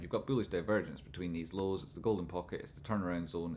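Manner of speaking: usual speaking voice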